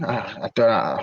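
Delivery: normal talking voice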